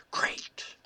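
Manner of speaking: breathy ghost voice on the recording; eerie voice of the EVP, whispered; ghostly whisper. Retold or imitated. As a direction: whisper it like an unseen spirit. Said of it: "you just hear this".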